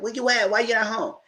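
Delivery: high accusing questions. Retold or imitated. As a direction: press him hard. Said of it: "She said".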